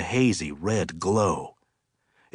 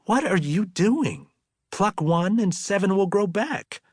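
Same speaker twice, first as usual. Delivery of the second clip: higher, exasperated protest; 10 semitones higher than usual. Acted. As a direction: brush him off with mock annoyance. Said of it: "giving her hair an exasperated toss".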